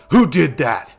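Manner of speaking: loud sudden angry bark; angry demand. Acted. Bully yelling at the cafeteria after being hit, angry. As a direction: demand who did it, loudly.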